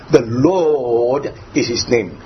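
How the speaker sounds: "Lord" stretched long; slow right down and dwell on "Lord"; a long, emphatic "Lord" before the rest; emphasis on Lord. Shown as a demonstration. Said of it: "Why the emphasis, emphasis there?".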